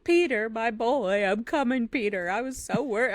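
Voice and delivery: a hint of a tired old dog greeting his boy; voicing Shadow. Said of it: "so it's like".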